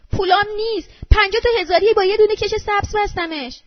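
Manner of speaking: high, loud wail like a wounded cat; thin shriek. A narrator's reading. Woman wails that her bundled money is gone, thin shriek. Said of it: "با صدای ریز که مثل صدای گربهٔ زخم خورده".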